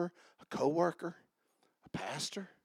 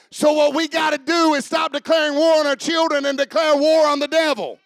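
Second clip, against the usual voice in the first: loud, high-pitched revival preacher; full-force preaching; 12 semitones higher, 13 dB louder than usual. Real, not acted. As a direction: shout it out to rouse the congregation.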